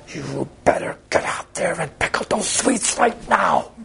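high, slow, held-back mock roar; strained, drawn-out angry order, pitched high; imitated furious yell. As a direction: act out a menacing yell without full volume. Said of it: "I yelled at the top of my lungs".

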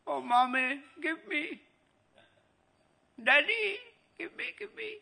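put-on high-pitched pleading child's cry. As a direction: imitate a little kid whining to parents. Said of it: "So you cried".

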